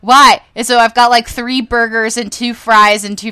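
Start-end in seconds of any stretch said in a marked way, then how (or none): 0.0-0.4 a plaintive why, then a loud wailing why; whiny protest. Retold or imitated. To mom about buying Frosties, loud mock-whiny protest. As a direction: protest louder on the second why. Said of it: "And I was like".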